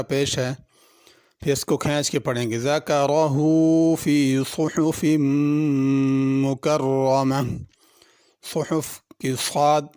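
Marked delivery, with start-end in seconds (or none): 2.6-7.4 slow, drawn-out melodic tajweed chant; Quran recitation. Acted. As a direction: recite slowly with long stretched vowels.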